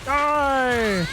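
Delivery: high, hammed-up shout of die; playful pretend war cry; mock battle cry. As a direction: yell it like an anime attack. Streamer yells attack in game.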